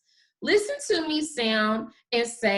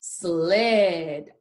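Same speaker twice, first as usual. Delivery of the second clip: sled as one whole word, less stretched; blended back together; 6 semitones lower than usual. Shown as a demonstration. Teacher finishing the word with the class, blended. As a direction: join the sounds into sled. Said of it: "we're going to do together".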